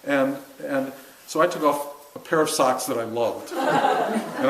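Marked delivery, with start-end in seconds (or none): 3.5-4.5 laughing. Real, not acted. Laughing at the memory.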